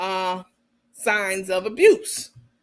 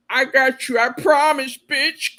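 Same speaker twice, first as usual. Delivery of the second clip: loud threat; 3 semitones higher, 5 dB louder, slower than usual. Retold or imitated. Carmen's threat replayed.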